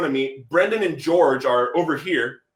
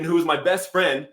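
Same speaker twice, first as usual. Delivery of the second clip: usual speaking voice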